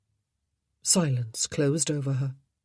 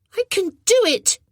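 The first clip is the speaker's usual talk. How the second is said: insisting; 8 dB louder than usual. A narrator's reading. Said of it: "he insisted".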